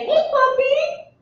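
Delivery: high, slow coo to a puppy; high cooing dog call; sweet baby talk. Acted. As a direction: call the puppy in a sing-song.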